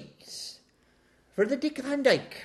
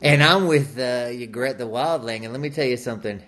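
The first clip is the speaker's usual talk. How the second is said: Matthew McConaughey impression; 8 semitones lower than usual.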